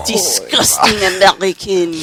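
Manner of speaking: put-on gasp, then sneer in French accent; shocked Frenchman, huffy; offended French accent.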